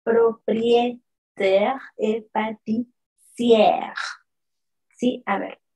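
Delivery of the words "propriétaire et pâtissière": propriétaire et pâtissière very slow, each syllable clear; slow French demo. Shown as a demonstration. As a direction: spell it out in sound.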